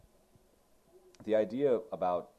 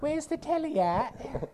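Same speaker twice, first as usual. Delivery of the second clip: much higher, slow hammed-up British voice; mock British accent; 4 dB louder, slower than usual. Mocking the movie's Brits with a slow, high British accent. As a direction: drag it out like a cartoon Englishman. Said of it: "they make fun of British people really bad".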